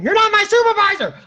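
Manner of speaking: put-on high, loud drunk scream; drunken scream. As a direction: scream it with full drunk defiance. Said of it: "so that I didn't scream".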